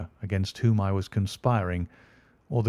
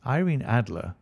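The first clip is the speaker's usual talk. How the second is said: plain reading voice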